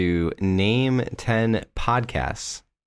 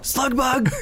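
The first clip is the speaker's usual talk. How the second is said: high, sudden jump-scare yell; sudden shout. Retold or imitated. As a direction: yell it out of nowhere. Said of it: "all of a sudden it's like".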